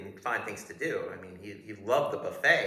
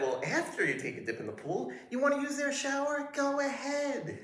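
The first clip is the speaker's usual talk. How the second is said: fawning guard voice. Retold or imitated. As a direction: gush like an overeager doorman. Guard voice, shower offer.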